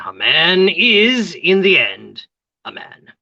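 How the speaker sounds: slow, drawn-out pronouncement; dramatic sigh. Acted. Gertrude sighs it out, slow and theatrical. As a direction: savour each word theatrically. Torn woman declares.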